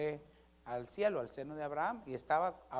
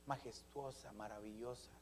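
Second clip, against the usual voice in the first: very soft, low, reverent; hushed awe; 8 semitones lower, 17 dB quieter than usual. Acted. Preacher answering how the Lord's voice is, hushed awe. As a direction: drop to an awed hush. Evokes God's majestic voice.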